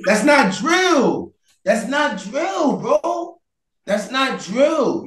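slow and high, each word hit; emphatic. Real, not acted. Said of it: "heated argument, slow emphatic".

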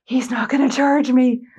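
high, put-on tearful voice; mock bawling. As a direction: imitate relief through tears. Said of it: "I'm still bawling".

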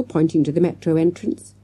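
ordinary delivery

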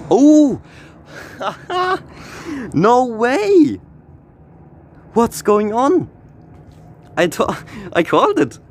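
shocked excitement. Real, not acted. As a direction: burst out in stunned delight. Rare car pulled from pack.

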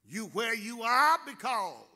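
rising, ringing preacher's cadence with loud peaks; preaching. Acted. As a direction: build the sermon to a shout. Preacher builds to full force.

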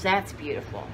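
ordinary talk

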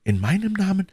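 tender, moved female character voice; deeply moved plea. Acted. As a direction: plead with lively emotion as the young woman. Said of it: "mit lebhafter Rührung".

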